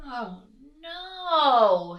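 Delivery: loud, high, swooping wail; Mister Bear groaning in despair; exasperated bear. A narrator's reading. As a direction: stretch it out in storybook dismay. Bear kept awake by aeroplane noises.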